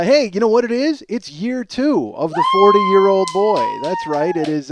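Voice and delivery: ordinary talk, nothing marked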